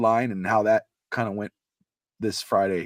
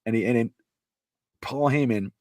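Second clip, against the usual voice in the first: ordinary talk, nothing marked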